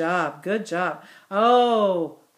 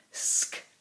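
whispered; 12 dB quieter than usual. Shown as a demonstration. Teacher to class, whispering the word first. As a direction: whisper Sky with no voice at all. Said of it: "Our next word".

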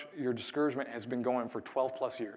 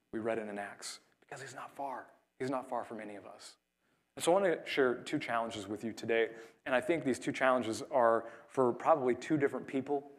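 ordinary talk

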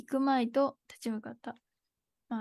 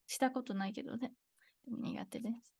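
sheepish whispered aside; 9 dB quieter than usual. Real, not acted. 遠距離恋愛の話の後に小声で打ち明ける. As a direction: whisper it like an admission. Confessing aside after rant.

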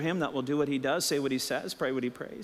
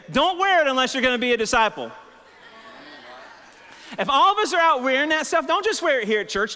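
loud, high exhortation to the church; rally the congregation; preaching; 12 semitones higher, 5 dB louder than usual. Acted. Pastor preaching at full force.

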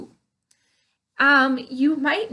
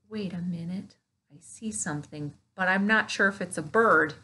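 ordinary talk, nothing marked

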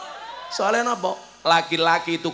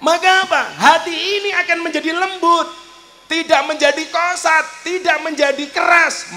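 high, loud preacher's cry; fiery preaching; 12 semitones higher, 6 dB louder than usual. Acted. Preacher rousing the nightclub crowd, loud and high.

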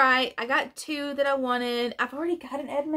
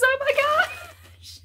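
high, slow delighted squeal; excited squeal; 3 dB louder, much slower than usual. Real, not acted. Unboxing a wanted toy, excited squeal. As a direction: squeal with joy.

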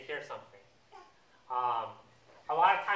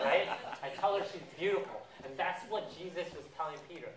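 usual speaking voice

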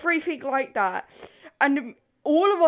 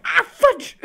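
higher and louder, caught off guard, then a high laugh; real yelp; 3 dB louder than usual. Real, not acted. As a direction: blurt it after stepping on something.

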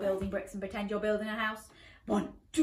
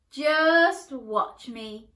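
defiant call, slow; 2 semitones higher, 7 dB louder, much slower than usual. Acted. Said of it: "called the princess".

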